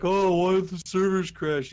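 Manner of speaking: mock a clueless player's outraged complaint; mock complainer. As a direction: imitate someone griping loudly. Mocking complaining beta players.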